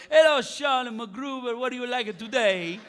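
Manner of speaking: put-on old barber's comic Italian accent. Old barber greeting his customer, Italian accent. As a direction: sing out the welcome in a thick Italian accent. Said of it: "old Italian barber".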